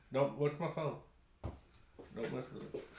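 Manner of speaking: aside whisper. Real, not acted. Aside to someone about phone.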